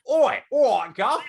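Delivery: high, loud British "oi" voice; mock British accent. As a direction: call out like a caricature Brit. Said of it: "instead you're just like".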